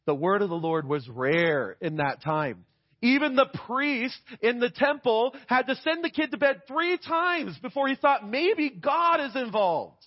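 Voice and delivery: ordinary talk, nothing marked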